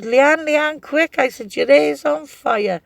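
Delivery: mock alarmed shout, high and loud; urgent call. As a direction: shout a warning across the room. Shouting warning about burning wig.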